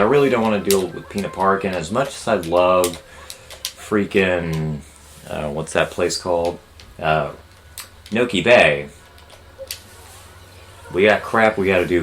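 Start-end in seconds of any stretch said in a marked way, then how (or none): none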